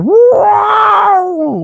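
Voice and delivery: very high, loud imitated whale wail; piercing mock scream; whale scream noise. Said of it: "like, whales screaming noises".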